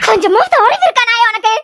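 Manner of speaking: loud, enraged tongue-lashing; furious berating. Acted. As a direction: shout him down in outrage. Mother berates drunk son.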